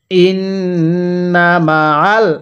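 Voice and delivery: stretched syllables, measured Arabic reading; slow recitation. Shown as a demonstration. Teacher models the word slowly for learners to copy. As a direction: draw out ওয়া র ফা না evenly. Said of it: "কিভাবে শিখব?".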